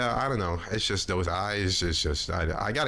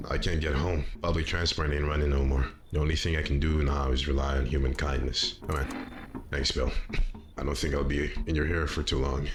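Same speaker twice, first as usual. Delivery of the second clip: lower, subdued voice of a lone survivor; defeated firefighter; 3 semitones lower than usual. Acted. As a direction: play the resigned firefighter, pitched down.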